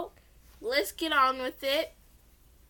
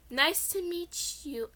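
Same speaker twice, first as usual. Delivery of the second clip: nervous; 6 semitones higher, slower than usual. Acted. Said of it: "tried to speak as I gulped".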